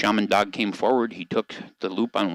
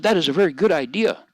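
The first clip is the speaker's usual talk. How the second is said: higher and louder, bright agreement; eager Shaman Dog; 6 semitones higher, 4 dB louder than usual. Acted. Shaman Dog eagerly answering President Dog, higher and louder. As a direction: jump in eagerly, pitched up.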